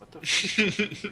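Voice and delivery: high, slow, loud disbelief; shocked outburst. Real, not acted. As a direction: blurt it in shock.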